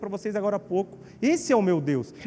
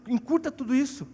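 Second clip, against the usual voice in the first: normal talking voice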